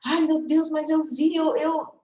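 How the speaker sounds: a hint of a dismayed learner; protesting student. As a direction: mimic a student's protest, stumbling on the last word. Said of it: "Por mais que você".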